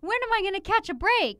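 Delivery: mocking whiny complaint. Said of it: "You'll be like".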